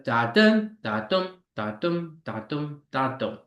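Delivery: five even heartbeat pairs, "da" light, "dum" stressed; iambic beat. Shown as a demonstration. Teacher sounding out the metre for students, rhythmic and stressed. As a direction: chant "da dum" with the weight on "dum".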